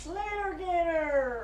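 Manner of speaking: drawn-out catchphrase. Pretend.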